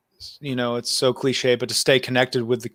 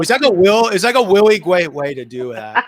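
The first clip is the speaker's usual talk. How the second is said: teasing; 6 semitones higher, 6 dB louder than usual. Pretend. Teasing echo of his phrase.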